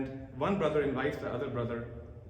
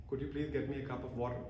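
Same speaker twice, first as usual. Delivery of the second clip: soft request; 3 semitones lower, 7 dB quieter, faster than usual. Shown as a demonstration. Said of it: "The words are exactly the same".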